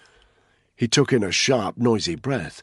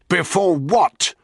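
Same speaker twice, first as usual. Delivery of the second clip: loud, higher, drawn-out question; shouted demand; 11 semitones higher, 7 dB louder, slower than usual. A narrator's reading. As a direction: shout for the rest of the answer. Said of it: "Danny shouted".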